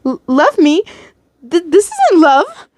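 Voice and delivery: high, stammering indignant protest; bursting out. A narrator's reading. Captive rejecting the captor's claim of love, outburst. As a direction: burst out in disbelief. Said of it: "you bursted out".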